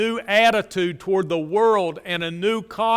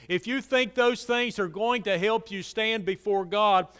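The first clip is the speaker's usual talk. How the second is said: ordinary talk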